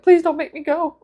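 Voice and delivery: high, small mock-pleading voice; pleading cat. Stray kitty begging to stay inside, high mock plea. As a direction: plead like a little kitty hiding. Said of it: "ran and hid behind my husband, like".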